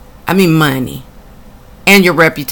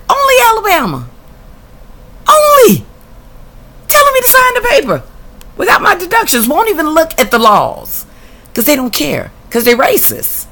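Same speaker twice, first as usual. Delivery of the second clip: exasperated rant; 13 semitones higher than usual. Real, not acted. Ranting about racist officials.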